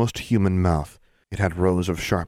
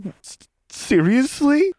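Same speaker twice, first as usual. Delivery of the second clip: much higher, slow stammered disbelief; stunned disbelief; 5 dB louder, slower than usual. Acted. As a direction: stutter it out in shock. Shocked stammer at dirty riddle.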